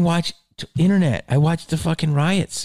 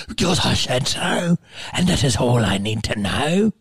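tyrannical nun. Retold or imitated. Storyteller repeats the nun's decree, imperious mock scolding. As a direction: lay down the law like a despotic captain. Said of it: "Like fucking Captain Bligh".

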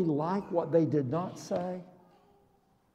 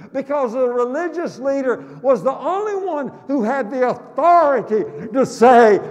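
normal talking voice

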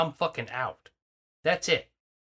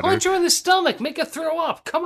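yelling at the screen; 10 semitones higher than usual. Acted. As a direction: shout at the character on screen. Yelling advice at the movie.

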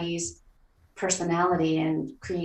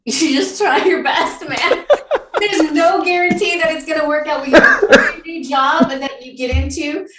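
loud, high, laughing reply; helpless laughter running through the answer; laughing while talking; 10 semitones higher, 10 dB louder than usual. Real, not acted. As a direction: laugh your way through the words.

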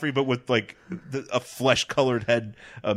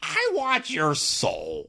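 slow, high, otherworldly villain voice; slow creepy villain voice; 11 semitones higher, much slower than usual. Retold or imitated. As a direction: drag it out like a demon. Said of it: "What do you want for Christmas, bald guy".

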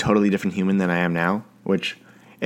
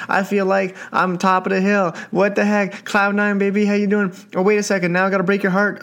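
much higher, swooning and giddy; hammed-up lovestruck delight; mock gushing; 10 semitones higher than usual. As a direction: act out being flattered. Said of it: "you're like".

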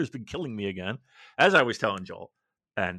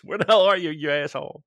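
louder, annoyed mock yell; mock angry text; 3 semitones higher, 8 dB louder than usual. Friend texting where are you, annoyed. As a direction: bark it like an impatient friend. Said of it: "fucking nine texts".

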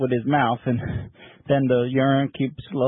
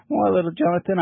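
pappy's voice; 7 semitones higher than usual. Retold or imitated. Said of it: "He says".

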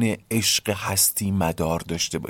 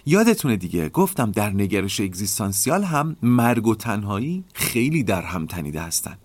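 normal talking voice

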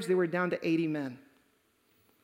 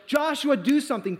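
high yelled plea, easing off at the end; yelling; 6 semitones higher, 4 dB louder than usual. Retold or imitated. Brothers plead with Joshua under attack, yelling. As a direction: yell it as an urgent plea. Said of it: "and they're yelling".

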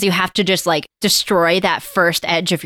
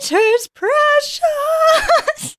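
very high, very slow laughing shriek; over-the-top Gollum; much slower than usual. Pretend. Hamming Gollum to make friends laugh, shrill and slow. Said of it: "embarrass yourself more than Rachel just did".

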